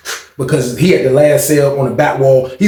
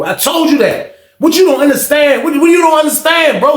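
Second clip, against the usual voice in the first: higher-pitched retold outburst, pressing the question; mock raised voice, exasperated at a cellmate; telling off a cellmate about the debt, voice raised higher; retold getting loud; 11 semitones higher than usual. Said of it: "So I kinda get loud with him".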